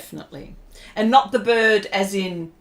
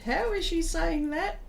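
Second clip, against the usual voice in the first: confused listeners; 8 semitones higher, 4 dB quieter than usual. Retold or imitated. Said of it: "and they go".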